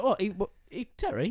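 high-pitched mock disbelief; halting, high retold outburst; imitating mum's indignation. Retelling how Mama T jumped in on him, mock indignant. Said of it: "And she was like".

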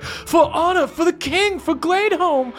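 high heroic cry; loyal guard. Acted. A loyal guard shouts for the king, high and heroic. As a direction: proclaim loyalty with bravado. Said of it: "anyone that is just like".

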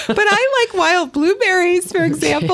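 laughing. Real, not acted. Host laughs while defending wild blueberries. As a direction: let the laugh carry the line.

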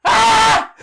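high loud mock scream; a high, loud imitated scream; retold scream. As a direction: yell "Ah!" like the figure charging. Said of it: "And then she hears".

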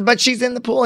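mildly protesting, pitched high; retold high-pitched objection; arguing. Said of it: "he's arguing".

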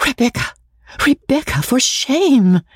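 gasp, then a high, scandalised cry; shocked cry. A narrator's reading. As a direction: cry out in horror at the blasphemy. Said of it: "cried Miss Sedley".

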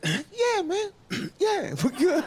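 very high, squeaky nervous yes; nervous agreement. Retold or imitated. Comic answers the stern native man, high and nervous. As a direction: squeak it out, backing down. Nervous reply after correction.

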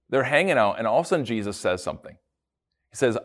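ordinary delivery

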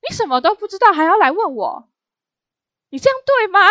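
imitating a boss's harsh rebuke; scolding. Manager berating the new auditor for asking, mock angry scold. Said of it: "他就是疯狂的指责我".

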